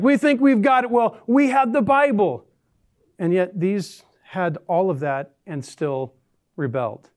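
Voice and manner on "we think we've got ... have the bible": smug. Retold or imitated. Mocking smug believers again.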